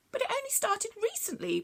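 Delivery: high little creature protesting; Bronglae's voice. Acted.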